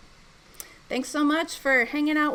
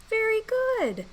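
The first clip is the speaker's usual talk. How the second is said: put-on lightly bright coaching voice for kids; overbright kids party host; slower than usual. Shown as a demonstration. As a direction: coax the class gently through blending. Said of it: "back to my coaching voice".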